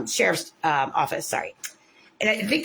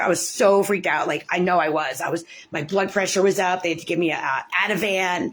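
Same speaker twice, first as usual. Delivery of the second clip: ordinary talk, nothing marked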